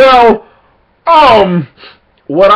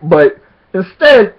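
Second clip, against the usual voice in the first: usual speaking voice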